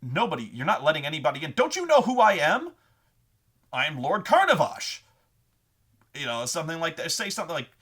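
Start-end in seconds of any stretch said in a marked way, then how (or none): 0.0-5.0 loud, indignant self-important nobleman; bluffing lord. Acted. Bluff character refused entry, pompously outraged. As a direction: demand to be recognised, rising to a shout. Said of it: "the bluff character is like".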